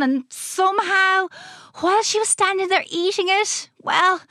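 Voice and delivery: high, excitable, halting explanation; rueful mad scientist. A narrator's reading. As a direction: explain the mishap with awkward hesitation.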